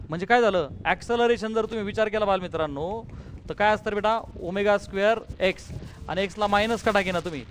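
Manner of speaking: ordinary delivery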